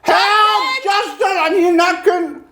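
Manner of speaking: high, loud panicked cries for help; panicked shout. Real, not acted. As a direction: call out for help, half laughing, half gagging. Gagging, calls for Justin.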